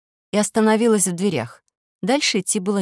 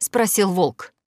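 ordinary delivery

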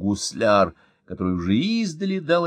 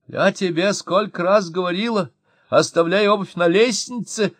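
high, loud nagging yell; scolding shout; 9 semitones higher, 4 dB louder than usual. A narrator's reading. Wife shouting at husband about shoes, loud and high. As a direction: yell the old reproach at him. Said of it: "крикнула жена".

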